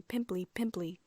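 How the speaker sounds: usual speaking voice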